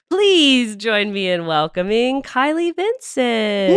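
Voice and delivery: big, drawn-out welcome; loud, high show-opening announcement, stretched slow; announcer introduction. Pretend. Host welcomes the guest to the show, big announcer voice.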